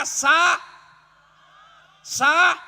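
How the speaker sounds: loud stuttering cry; loud shout. Real, not acted. Preacher shouting to get attention, loud. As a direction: call out over the crowd. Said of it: "dengar sedulur-sedulur".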